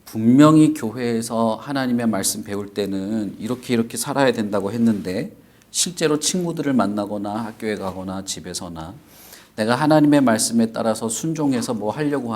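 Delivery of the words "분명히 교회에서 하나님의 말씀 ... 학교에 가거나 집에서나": ordinary delivery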